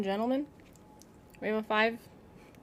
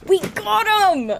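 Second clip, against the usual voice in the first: loud, high, drawn-out victory yell; real elated holler; triumphant shout; 12 semitones higher, 11 dB louder, slower than usual. Real, not acted. Celebrating the boss kill.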